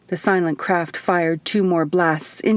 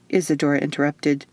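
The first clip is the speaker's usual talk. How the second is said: ordinary delivery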